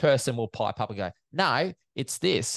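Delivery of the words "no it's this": higher-pitched, drawn-out interjection; mock correction. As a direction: pipe up as a bystander correcting the announcer. Acting out bystander piping up to correct.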